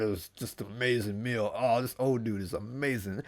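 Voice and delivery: sated, soft mumble; stuffed groan. Acted. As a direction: sigh it out, too full to walk. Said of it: "just hobbling home like".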